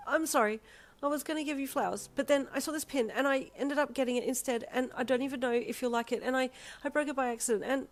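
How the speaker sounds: high, rushed-sounding anxious confession; nervous apology. A narrator's reading. Visitor confessing a broken gift to a friend, anxious.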